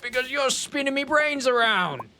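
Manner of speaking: high, hammy Cockney Englishman; hammed-up Cockney. Streamer playing a baffled Victorian Englishman, high hammy Cockney. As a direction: ham up a Victorian Londoner's Cockney. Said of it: "they'd be like".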